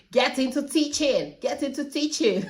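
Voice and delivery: high, insistent chanted slogan; high-pitched mock shouting; playful shouting. Shown as a demonstration. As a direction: shout it out like a repeated plug. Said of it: "I keep shouting it".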